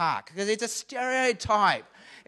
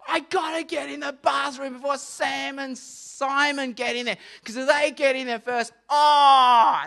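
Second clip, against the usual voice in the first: higher, hammed-up hurried dad voice; dad dreading his sons' stench, mock panic; imitating dad; 7 semitones higher, 8 dB louder than usual. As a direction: play dad racing his sons to the bathroom. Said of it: "he's waking up in the morning saying".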